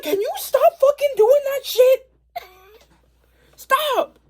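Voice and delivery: shrill fed-up scolding; exasperated yell. Retold or imitated. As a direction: tell him off, fed up with his tricks. Scolding Hawks after near fall.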